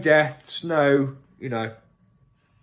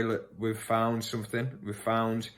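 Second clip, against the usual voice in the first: mock doctor on phone; 2 semitones lower, 7 dB quieter, slower than usual. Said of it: "I'll say I'm a doctor".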